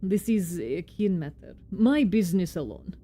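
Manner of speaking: put-on amateur Russian-accented foreman; bad Russian accent. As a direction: play him with a clumsy Russian accent. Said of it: "my very bad Russian accent".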